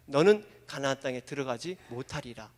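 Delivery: low, soft solemn verdict; low quiet decree. Acted. Preacher voicing God's quiet sentence on Moses, low and solemn. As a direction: deliver it low and final.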